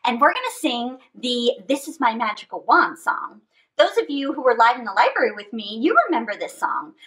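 plain reading voice

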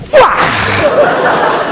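sudden high mock yelp; yelp of pain. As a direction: yelp as the kick backfires. Kicks out the knee pain.

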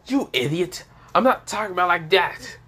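high, flustered denial; indignant protest. Acted. Flustered character denies the implication.

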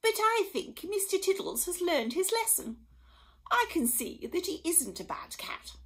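the Queen relenting graciously; lightly voiced queen. A narrator's reading.

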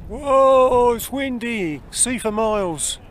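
loud and high over the wind; shouting over wind. Real, not acted. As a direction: call out over a strong wind.

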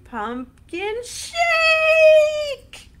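slow, high, loud singsong shout. Shown as a demonstration. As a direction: belt out the word shake. Chant, shouted ending.

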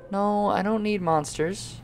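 soft, drawn-out objection; quiet, wary protest; uneasy refusal. Pretend. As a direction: say "No, I don't need monsters" softly, trailing upward. Objects to monsters appearing.

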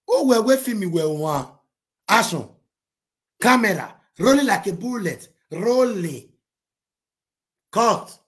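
hammed-up director shouting cues; film director calls. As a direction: bark set calls like a director on set. Imitating film set director calls.

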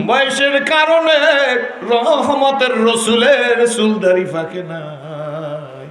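high, loud, drawn-out chant; melodic preaching lament. Acted. Preacher voicing the Prophet consoling Aisha, high drawn-out chant. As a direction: stretch the line endings in a loud wail. Half-sung sermon dialogue.

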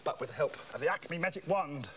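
usual speaking voice

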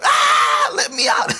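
loud, very high mock scream; hammed-up panicked shriek; acted-out freakout. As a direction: scream like a kid trapped in the dark, playfully retold. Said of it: "so I'm freaking out".

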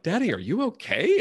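a light touch of a concerned daughter; worried child. Retold or imitated. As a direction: lift the voice up like a small child.

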